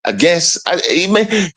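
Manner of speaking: usual speaking voice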